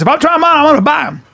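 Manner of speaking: high, insistent mimicry; excited shopper imitation. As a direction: answer back in the shopper's high voice. Acting out the shopper's reply.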